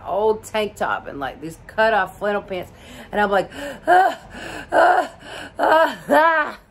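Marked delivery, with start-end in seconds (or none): 3.5-6.6 high, loud gasping ahs; reenacted breathless gasps, high and loud; gasping for breath. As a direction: pant out each ah as if out of air. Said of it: "gasping for breath".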